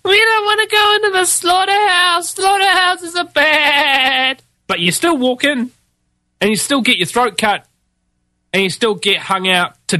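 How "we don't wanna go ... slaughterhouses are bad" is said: high, slow, bleating whine; mock the complaining sheep in a high whine; whiny sheep. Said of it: "complain like a bunch of sleep sheep going".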